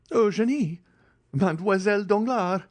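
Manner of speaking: high, stunned cry of the names; stupefied exclamation. A narrator's reading. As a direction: blurt the names out in shock. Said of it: "exclaimed Andrea, stupefied".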